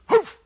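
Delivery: very high, loud single woof; dog bark. Acted. Dog answering the butcher, barking. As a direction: yip a sharp woof. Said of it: "And the dog goes".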